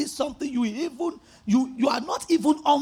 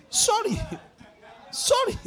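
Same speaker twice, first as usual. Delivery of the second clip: laughing mock apology; 10 semitones higher than usual. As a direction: laugh through an exaggerated sorry. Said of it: "I said".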